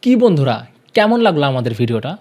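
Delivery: ordinary talk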